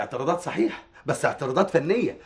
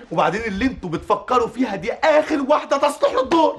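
indignant objection; 11 semitones higher than usual. Acted. Writer objecting to the casting, indignant. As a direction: pitch climbing high in heated protest.

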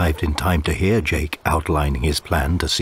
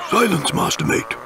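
higher captain's hushed rebuke; stern captain; 8 semitones higher than usual. Acted. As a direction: scold the mate. Pugwash scolds mate.

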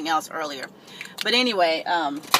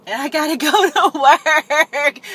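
laughing through the words, slow and loud; 7 semitones higher, 7 dB louder, slower than usual. Real, not acted. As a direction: giggle it out. Laughs about leaving for work.

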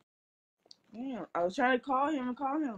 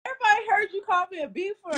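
fast, high, loud accusation; real yelling; 9 semitones higher, 7 dB louder, much faster than usual. Real, not acted. Accusing someone of name-calling first, yelling. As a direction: yell it out in a rush. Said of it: "who you yelling at".

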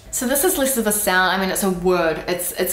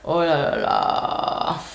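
slow, breathy fed-up oh la la; exasperated oh la la; 3 semitones lower, much slower than usual. Shown as a demonstration. Demonstrating exasperated oh la la. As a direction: sigh it out in exasperation.

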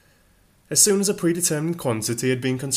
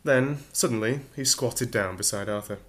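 usual speaking voice

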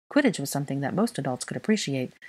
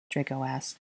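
plain reading voice

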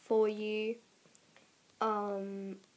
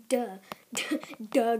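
usual speaking voice

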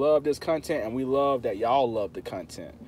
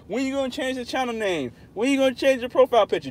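imitating commenters; 9 semitones higher, faster than usual. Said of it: "Every video".

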